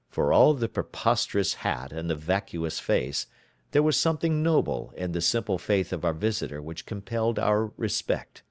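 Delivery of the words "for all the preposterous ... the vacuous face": normal talking voice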